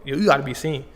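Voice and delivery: ordinary talk, nothing marked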